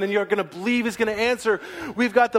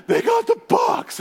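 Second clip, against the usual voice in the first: panicked shout; 4 dB louder than usual. Acted. Scout reports breathlessly.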